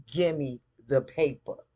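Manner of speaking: loud, emphatic mock demand. Acted demand in a recapped quarrel.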